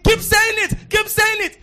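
loud, high shout of keep saying it; shouted quote. Retold or imitated. Preacher shouting to congregation, loud and high. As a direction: pastor shouts it at the crowd. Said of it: "when he's saying".

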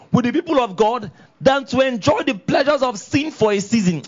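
high-pitched, ringing declamation; full-force scripture preaching. Real, not acted. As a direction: proclaim the verse to a whole congregation.

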